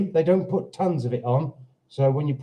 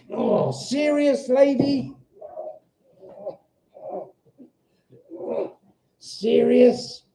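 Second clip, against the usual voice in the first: incredulous serious; 7 semitones higher, slower than usual. Real, not acted. Reacting to a comment.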